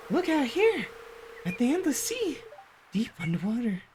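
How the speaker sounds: high, hushed spooky wonder; high eerie narrator. Acted. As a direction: marvel at the dark sea, high. Voices a game character.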